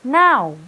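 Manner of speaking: Now, ow glide said loud. Shown as a demonstration. Teacher modelling Now loudly for the class. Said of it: "repeat after me again".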